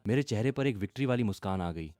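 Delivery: usual speaking voice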